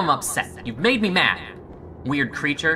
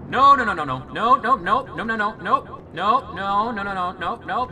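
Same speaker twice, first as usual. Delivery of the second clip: high panicked string of no's; panicked nos; 6 semitones higher than usual. Real, not acted. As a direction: blurt no over and over in fright. Panics at creature appearing.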